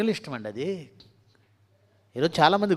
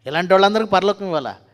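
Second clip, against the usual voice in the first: వాళ్ళందరికీ shouted higher and loud, falling at the end; preacher shouting the question louder the second time; loud rhetorical question; 4 semitones higher, 9 dB louder than usual. Real, not acted. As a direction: fire the question at the congregation.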